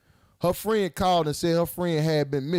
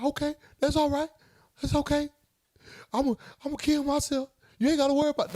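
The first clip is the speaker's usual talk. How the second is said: high, sing-song mock girlfriend; imitating a girlfriend's guilt trip; mock girlfriend threat; 12 semitones higher, faster than usual. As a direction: pitch up and play it dramatic.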